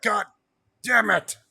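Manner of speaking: high, loud mock exasperation; exasperated. Wrestling fan groans at the match announcement, exasperated. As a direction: play up a frustrated outburst. Voicing exasperated reaction to booking.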